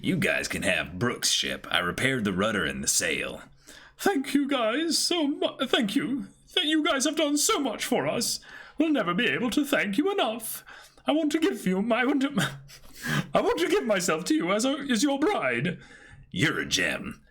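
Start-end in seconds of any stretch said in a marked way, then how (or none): 4.0-15.8 high, gushing voice; high-voiced woman gushing thanks to the crew. S1 voicing Lola thanking, proposing. 16.3-17.2 low, smug voice; low-voiced shipwright smugly declining; proud shipwright. S1 voicing the shipwright turning her down.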